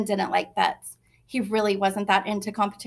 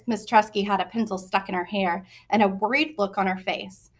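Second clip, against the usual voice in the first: usual speaking voice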